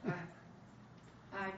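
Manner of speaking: halting, cut-off stammer with long pauses; words won't come. A narrator's reading. Son faces the audience, lost for words. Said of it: "but the words wouldn't come".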